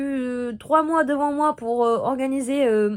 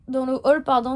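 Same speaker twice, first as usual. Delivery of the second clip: normal talking voice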